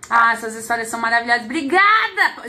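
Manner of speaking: usual speaking voice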